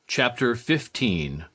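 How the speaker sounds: ordinary delivery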